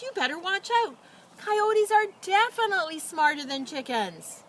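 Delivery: farmer's wife scolding the hen, a little out of breath; panting warning. Acted. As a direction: wag a finger while still catching your breath. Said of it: "the farmer's wife panted".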